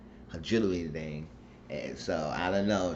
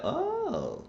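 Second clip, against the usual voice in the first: mock coy. [name] answering the phone.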